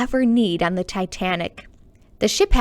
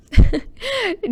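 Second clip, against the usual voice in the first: laugh; 13 semitones higher, 4 dB louder than usual. Real, not acted. Reader laughs after line.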